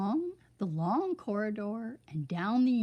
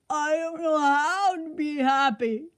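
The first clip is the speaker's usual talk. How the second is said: high, louder sniffly complaint; sniffling king; 7 semitones higher, 7 dB louder than usual. A narrator's reading. The king sniffling to Sunshine, high tearful whine. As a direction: sniff it out miserably. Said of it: "sniffed the king".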